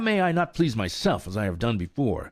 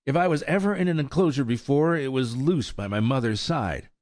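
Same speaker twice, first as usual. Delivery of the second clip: groaning; 6 semitones higher than usual. A narrator's reading. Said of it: "groaned Firefly".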